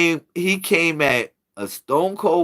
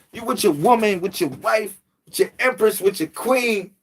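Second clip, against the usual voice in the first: usual speaking voice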